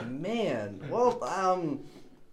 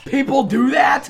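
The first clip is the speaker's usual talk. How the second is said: incredulous; 8 semitones higher, 9 dB louder, slower than usual. Real, not acted. Disbelief at a food choice.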